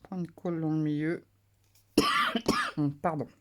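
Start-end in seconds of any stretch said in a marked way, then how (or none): 2.0-3.0 a short real cough. Real, not acted. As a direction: clear the throat with a cough. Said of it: "Désolée, je tousse encore un petit peu".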